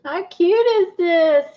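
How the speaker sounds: high, slow, adoring coo; delighted cooing. Real, not acted. Crafter admiring her cute pond scene, delighted coo. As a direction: stretch it out high, charmed.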